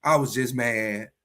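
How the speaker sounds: low, soft, contrite murmur; sheepish apology. Acted. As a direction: own up quietly to a partner after an outburst. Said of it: "now you gotta go back and say".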